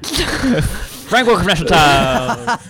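loud, high, slow mock station announcer; playful over-the-top radio ident; hammed-up radio announcer. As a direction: boom the station ID like an old-school announcer. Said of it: "we're doing, uh, radio voices right now".

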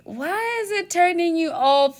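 high, drawn-out incredulous question; exasperated self-scolding. Retold or imitated. Speaker scolding herself over being put off, exasperated. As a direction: question yourself in slow, high-pitched disbelief.

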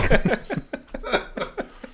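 high villain laugh. Pretend.

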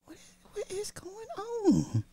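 mock frightened disbelief. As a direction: act scared and overwhelmed. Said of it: "my little heart can't take this shit".

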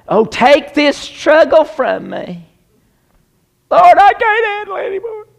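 wimpy whining prayer. Retold or imitated. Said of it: "Wimpy Christians in America".

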